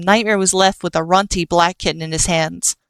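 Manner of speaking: ordinary delivery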